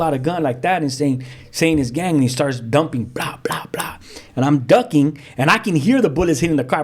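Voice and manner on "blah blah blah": whispered, much lower and softer gunshot noises; whispered gunfire. Retold or imitated. Retelling the gunman firing, hushed gunshot sounds. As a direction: mimic the shots under your breath. Gunman starts dumping shots.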